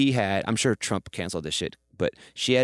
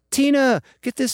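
high and loud, calling out an order; commanding high imitation; 8 dB louder, slower than usual. Imitating the first lady calling to her assistant Tina, high and loud. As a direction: summon the assistant like a queen. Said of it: "literally like".

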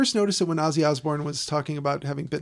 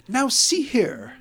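higher, slow, hammed-up old-film gent; mock stuffy gent, higher and drawn out; old movie gentleman; 8 semitones higher, 6 dB louder, much slower than usual. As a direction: puff up like a stuffy movie Englishman. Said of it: "men don't say".

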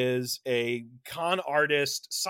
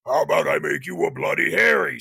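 a touch of Freddy Krueger, a bit louder; Freddy's voice; 4 semitones lower, 5 dB louder than usual. Retold or imitated. Possessed woman turning on the guy, in Freddy's voice. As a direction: let the killer's voice slip out of her mouth. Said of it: "says in Freddy's voice".

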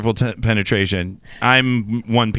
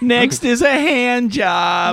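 teasing; 10 semitones higher, much slower than usual. Pretend. Joking about escalating footsie.

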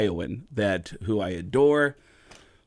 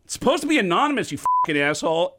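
angry fan; 8 semitones higher, 5 dB louder than usual. Retold or imitated. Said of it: "people tweet at the real Tom Arnold and go".

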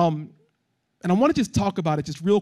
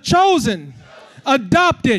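emphatic call to repeat; like you mean it; 5 semitones higher, 5 dB louder, much slower than usual. Shown as a demonstration. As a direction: declare each word with conviction. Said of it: "say it like you mean it this time".

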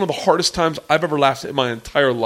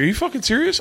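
incredulous Roy; 10 semitones higher, faster than usual. Retold or imitated. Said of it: "And he was like".

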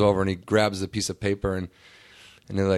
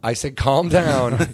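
"calm down" stretched slow and louder; retold firm insistence; 4 semitones higher, 5 dB louder, much slower than usual. Fan repeats himself to the guard, "calm down" slow and emphatic. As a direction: insist on "calm down", drawing it out loudly.

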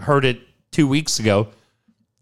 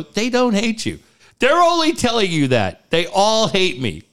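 high, excited reassurance; 9 semitones higher than usual. Retold or imitated. Reassuring his upset wife.

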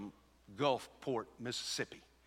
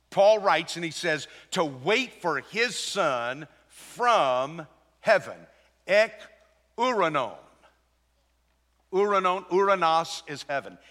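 raised pulpit voice, hammering the key words; forceful preaching; 5 semitones higher, 7 dB louder than usual. Real, not acted. Preacher shows church the second coming in each chapter, forceful preaching. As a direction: read and expound scripture with driving emphasis.

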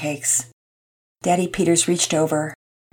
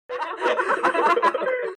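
laugh; 6 dB quieter than usual. Acted. Family laughs in car.

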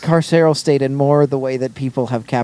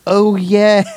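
higher, louder, drawn-out realization; mock sudden recollection; 7 semitones higher, 5 dB louder than usual. Mocking the mother recalling her baby, exaggerated sudden recollection. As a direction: act as if it just dawned on you.

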